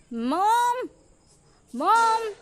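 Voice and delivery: high, loud shouts for mom; calling out loudly. A narrator's reading. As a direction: yell across the house for mom. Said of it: "ज़ोर-ज़ोर से आवाज़ देना". (ज़ोर-ज़ोर से माँ को पुकारना)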